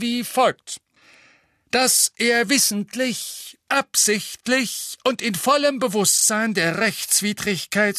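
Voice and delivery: loud, high, formal reading of charges; pompous official reading out a charge sheet; reading aloud loudly. Acted. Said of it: "und las laut".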